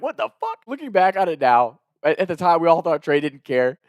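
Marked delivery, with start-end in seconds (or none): 0.0-0.6 surprised. Retold or imitated. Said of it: "we're like".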